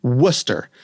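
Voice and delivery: Worcester said WUSS-ter; Worcester clipped to two syllables, WUSS-ter; local pronunciation. Shown as a demonstration. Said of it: "they pronounce".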